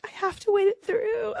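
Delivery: high, crying through the words; tearful. Retold or imitated. Mother in labor waiting for the new doctor, crying high. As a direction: hold on while half crying.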